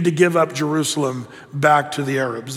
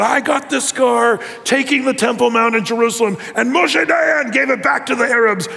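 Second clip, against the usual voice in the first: old soldier showing his scar, mildly voiced anger; imitating a furious veteran; 6 semitones higher than usual. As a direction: voice the old soldier's angry grievance. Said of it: "He was, like, furious".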